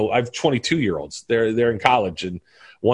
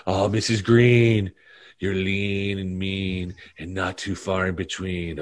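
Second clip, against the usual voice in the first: low, slow, soft creepy voice; Carl Spackler voice; 6 semitones lower, 3 dB quieter, slower than usual. Retold or imitated. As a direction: drawl it like a groundskeeper. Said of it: "I love when he goes".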